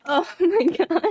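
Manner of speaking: high laughing words; giggling through it. Real, not acted.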